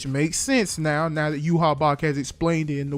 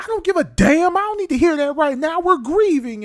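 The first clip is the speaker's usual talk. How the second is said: much higher and louder, a grieving captain snapping; furious outburst; 10 semitones higher, 5 dB louder than usual. Retold or imitated. Soifon snapping at the captains who tell her to move on, high furious outburst. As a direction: hurl it back in a shrill, high rage. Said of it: "Soifon was like".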